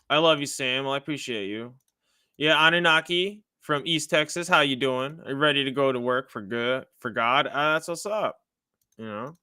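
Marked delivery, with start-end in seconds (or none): none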